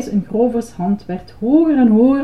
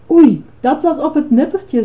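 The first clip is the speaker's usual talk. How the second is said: higher-pitched yelp of relief; startled; 6 semitones higher, 4 dB louder than usual. A narrator's reading. Ice-cream man after a near fall, startled. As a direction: react with a start. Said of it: "schrok Grover".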